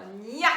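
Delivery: put-on loud, clear "gna" for children; teacher repeating "gna" firmly; syllable drill. Shown as a demonstration.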